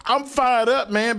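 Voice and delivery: usual speaking voice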